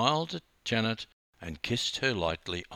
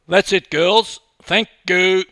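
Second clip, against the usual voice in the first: loud, high photographer's call; 11 semitones higher, 14 dB louder than usual. A narrator's reading. Photographer ending the shoot, calling out. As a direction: call it across to them. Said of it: "called the photographer".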